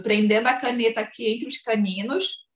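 ordinary talk, nothing marked

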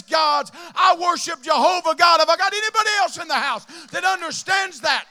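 loud, high fervent preaching. Acted. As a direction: shout it to the whole house. Preaching at full force.

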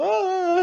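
a sudden high-pitched mock "ah"; mock high yelp. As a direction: imitate a gasping yell, high. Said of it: "hasta se ponen como". (grito agudo imitado)

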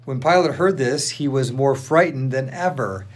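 usual speaking voice

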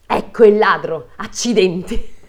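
loud accusing cry; exclaiming. Acted. Wife blaming the fly, loud exclamation. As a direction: point out the culprit loudly. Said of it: "esclamò".

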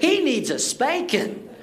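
put-on high, slow and drawn-out child's decree; bossy little sister. Little sister instructing parents on her brother's punishment, bossy mock child voice. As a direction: imitate a little girl laying down the law. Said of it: "she decided to instruct us in the punishment".

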